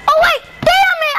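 real gamer rage shout; frustrated shout. Real, not acted. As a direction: shout it when the jump fails. Missed jump, repeats louder.

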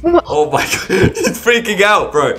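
high-pitched, breaking into real laughter; laughing excitedly. Real, not acted. YouTuber watching a streamer freak out over Robux, laughing hard. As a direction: crack up while exclaiming.

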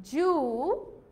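জুয়েলারি broken into joo... wel... ree; drawn-out জুয়েলারি, a pause after each syllable; syllable-by-syllable pronunciation. Shown as a demonstration. Teacher breaks it down for learners, জুয়েলারি as separate joo, wel, ree. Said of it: "what will be the original pronunciation".

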